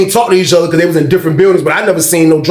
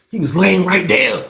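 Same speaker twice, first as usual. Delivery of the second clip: ordinary delivery, nothing marked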